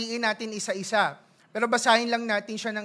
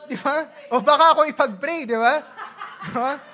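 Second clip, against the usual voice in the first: louder, laughing through the joke; 3 semitones higher, 6 dB louder than usual. Real, not acted. Pastor joking to his congregation, laughing loudly. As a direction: chuckle while teasing the crowd.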